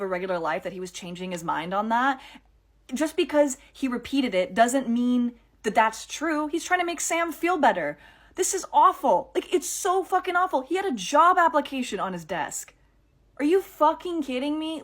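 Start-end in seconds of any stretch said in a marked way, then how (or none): 6.5-14.8 higher, rising outrage; a fan fuming over Dean's death; outraged. Real, not acted. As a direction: vent at the writers.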